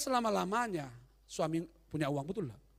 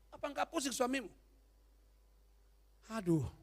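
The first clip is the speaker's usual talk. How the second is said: normal talking voice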